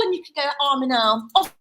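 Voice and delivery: plain reading voice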